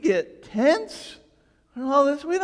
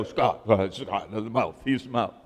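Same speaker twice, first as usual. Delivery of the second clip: much lower, mock voice of people pointing him out; mock reporters' voice; 11 semitones lower than usual. Pastor mimicking his flock to TV cameras, low mock voice. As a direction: lower your voice to mimic the scattering crowd.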